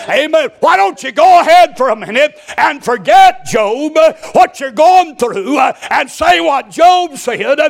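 loud, high, slow preacher's call; preaching exhortation. Real, not acted. Pastor urging the congregation to say Job's words, loud and high. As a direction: lift the congregation with a ringing cry.

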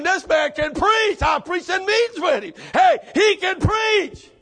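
fiery shouted preaching. Acted. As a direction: preach it with full force, slow and loud. Preaching at full force.

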